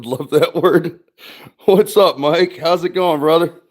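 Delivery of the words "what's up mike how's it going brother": higher upbeat hello; friendly greeting. Real, not acted. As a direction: welcome a regular warmly. Streamer greets chat viewer.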